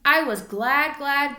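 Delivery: usual speaking voice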